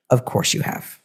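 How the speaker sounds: a soft, breathy whisper: "Of course you have"; whispered reassurance. Real, not acted. Reader to Virgo risings, reassuring in a whisper. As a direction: whisper it close, like a secret comfort.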